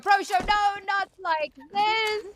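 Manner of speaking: high, loud, drawn-out dismay; anguished excited cry. Real, not acted. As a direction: cry out in disbelief, stretching it. Caster reacts to knife kill.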